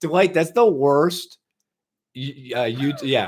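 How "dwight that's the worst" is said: drawn-out fake outrage; mock whiny complaint. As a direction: whine it slowly, pretend hurt.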